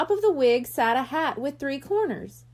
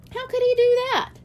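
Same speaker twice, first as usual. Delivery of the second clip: put-on high, louder child's question; high wondering girl's voice; curious kid; 12 semitones higher, 4 dB louder than usual. A narrator's reading. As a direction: ask like an amazed little girl. Child asking.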